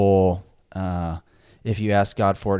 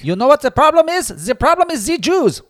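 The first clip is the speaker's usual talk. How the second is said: much higher and louder, a shouted ranting dictator; mock Hitler rant; 10 dB louder than usual. Joking about Hitler in a support group, shouting a ranting dictator. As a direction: ham up a ranting dictator for a laugh.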